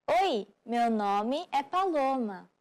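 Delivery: "Oi, meu nome é Paloma" said slowly, each word spaced out; slow it right down for repeating; slow model. Shown as a demonstration. Teacher repeating the phrase slowly for learners to copy, slow. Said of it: "Let's check what you've learned".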